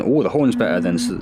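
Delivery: ordinary delivery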